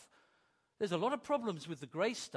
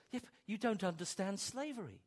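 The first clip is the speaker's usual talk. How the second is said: ordinary delivery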